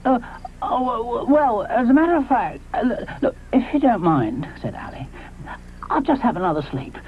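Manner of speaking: hesitant, stumbling excuse; stammering Ally. Acted. Ally dodges Moschops's question, stammering and evasive. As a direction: trip over the words, evasive. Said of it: "Ally stammers evasively".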